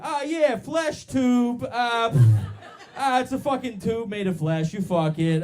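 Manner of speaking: loud, high, hammed-up leering voice; crude guy parody. Comedian mocking guys' toy names, crude and loud. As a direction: blurt it out like a crass product pitch.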